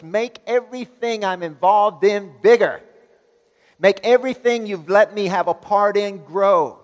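loud, high, slow impassioned prayer; preaching at full force. Acted. Preacher voices Jabez's prayer at full power. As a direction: cry it out to God, building.

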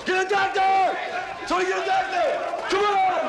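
loud, high, desperate shouts; urgent call for help. Acted. Trainer calls out for a doctor, panicked shout.

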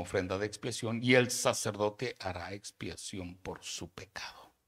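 preacher reading Leviticus at full force; forceful scripture reading. Acted. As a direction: proclaim each clause to the hall.

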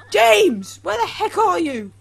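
high, loud exasperated shouting; frantic calling out. Real, not acted. Gamer hunting for the missing date, frantic calling out. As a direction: yell for someone who has wandered off.

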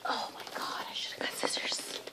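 unvoiced whisper to herself; whispered aside while unboxing. Real, not acted. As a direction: hush it under your breath. Unboxing muttering to self.

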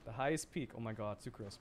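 ordinary delivery

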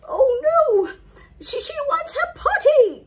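high, loud, frantic maid; panicking maid. Acted. The maid alarming the castle, high frantic panic. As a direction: gasp and blurt it in panic. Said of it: "cried the maid".

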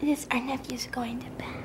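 unvoiced whisper, puppy asleep. Real, not acted.

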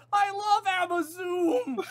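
very high, slow, loud mock cheer; hammed-up adoration. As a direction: squeal it out with drawn-out fake devotion. Hamming up love of parody brand.

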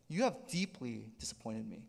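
low, soft, mildly disappointed reproach; understated, heavy letdown; disappointed father. Retold or imitated. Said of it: "he's so disappointed".

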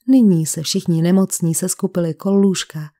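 ordinary delivery

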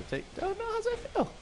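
very high squeaky taunting voice; high mocking taunt.

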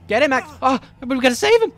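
frantic high yelling; panicked shouting. Real, not acted. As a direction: scream at him to run. Panicking as friend attacked.

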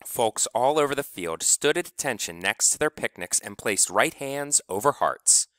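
ordinary delivery

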